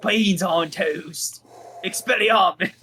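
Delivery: slow, drawn-out put-on British accent; mock British accent, clearly playful. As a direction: savour it like a hammy Brit. British bit continues.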